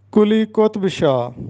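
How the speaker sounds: قطب said as "qotb", no vowel between t and b; کلی قطب شاہ spoken "kuli qotb shah"; careful teacher's model of کلی قطب شاہ; correct pronunciation. Shown as a demonstration. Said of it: "یہ درست تلفظ ہے".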